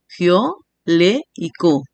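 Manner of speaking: "gio, le y co" slow and separated; slow, spaced-out "gio, le y co"; syllable by syllable. Shown as a demonstration. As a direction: sound out "gio", "le", "co" one at a time. Teacher names syllables in reverse.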